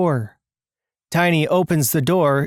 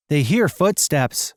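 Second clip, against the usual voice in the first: usual speaking voice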